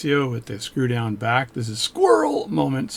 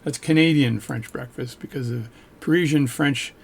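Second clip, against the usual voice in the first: ordinary talk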